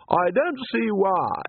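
put-on high, drawn-out girl's protest; slow, piercing complaint that saws through the air; cutting voice. A narrator's reading. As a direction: pitch it high and stretch every word. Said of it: "cut through the mist like a saw".

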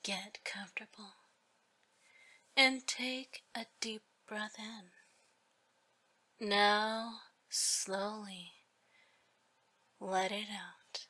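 usual speaking voice

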